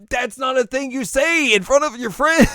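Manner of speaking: very high, loud outrage; scandalized scolding. Retold or imitated. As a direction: shriek the rebuke in mock horror.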